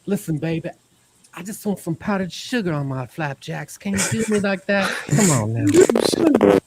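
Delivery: high, crooning mock lover; smooth Chris. Chris sweet-talking for powdered sugar, crooning. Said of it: "voicing Chris".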